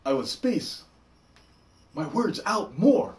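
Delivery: hammed-up dramatic pauses between words; William Shatner. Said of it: "If I was being William Shatner".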